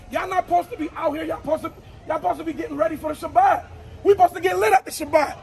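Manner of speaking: ordinary delivery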